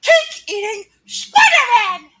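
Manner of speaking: high, loud squealing aunt rant; J J's rant in Aunt May's voice. Acted. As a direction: rant like a shrieking old aunt. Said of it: "J J's voice done in Aunt May's voice".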